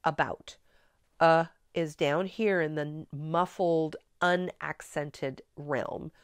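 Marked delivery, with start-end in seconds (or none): 0.0-0.6 natural "about", second syllable stressed; stress on BOUT. Shown as a demonstration. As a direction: let the "a" in "about" shrink, lean on "bout". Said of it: "We say it like that".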